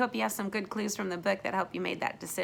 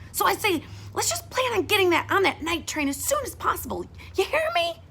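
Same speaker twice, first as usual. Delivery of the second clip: put-on high, pushy girl rising louder; bossy big sister; 8 semitones higher, 4 dB louder than usual. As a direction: lecture your little brother urgently. Florida lectures her brother.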